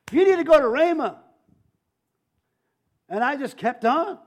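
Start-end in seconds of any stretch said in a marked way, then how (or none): 0.1-1.2 louder, higher, more forceful voice of the Lord; insistent divine command. Retold or imitated. Second voice from the Lord to a praying man, insistent. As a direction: press the command firmly and loudly. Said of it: "I heard the second voice said to me".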